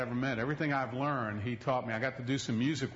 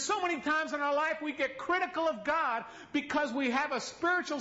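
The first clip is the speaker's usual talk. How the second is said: high, slow, raised preaching; preaching at full force; 13 semitones higher than usual. Acted. Preacher to congregation, high and impassioned. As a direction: press the question on the congregation.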